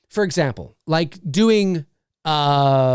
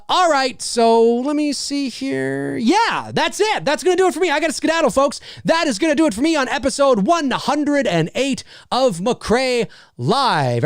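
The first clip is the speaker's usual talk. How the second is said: high-pitched announcer wrap-up; show host sign-off; 8 semitones higher than usual. Acted. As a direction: sing out the show's closing like a host. Show host's sign-off routine.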